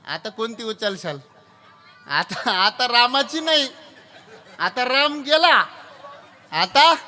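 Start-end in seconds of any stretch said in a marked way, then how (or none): 2.1-6.9 slow, high and louder, laughing through the words. Real, not acted. Preacher laughing at his own gold idol joke, slow, high and louder. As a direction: laugh the punchline out.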